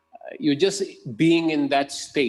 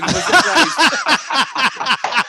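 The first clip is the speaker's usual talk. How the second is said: high, loud, slow teasing chant through laughter; laughing tease; 9 semitones higher, 10 dB louder, much slower than usual. Real, not acted. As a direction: drag it out playfully while laughing.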